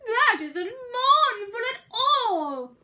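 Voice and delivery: high, loud, slow wailing bear; cried out. Acted. Bear protesting Mouse's banjo, wailing. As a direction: wail it in protest. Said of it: "cried Bear".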